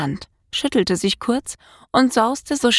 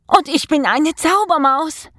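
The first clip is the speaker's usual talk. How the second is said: high-pitched excited exclamations; thrilled; 7 semitones higher than usual. A narrator's reading. Mouse finds his magic powder, elated. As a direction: marvel aloud, pitch jumping up. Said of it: "Er war begeistert".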